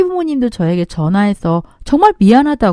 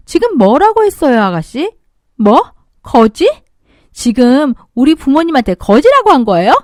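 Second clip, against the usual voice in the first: rising, louder angry challenge; cold anger; 3 semitones higher, 4 dB louder than usual. Acted. Confronting sister-in-law over the insult, angry. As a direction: confront her, voice getting louder.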